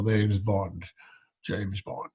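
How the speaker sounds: put-on Scottish-accented Bond line, lazy and smooth; Sean Connery. Impressionist doing Connery's famous line in Scottish accent. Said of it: "Sean Connery, a wonderfully sexy accent".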